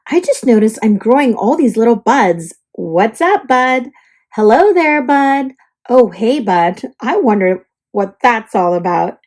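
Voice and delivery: ordinary delivery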